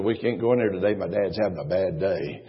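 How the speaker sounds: put-on quiet, low childish warning; hushed child. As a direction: hush it like a child keeping a secret. Child whispering to friend.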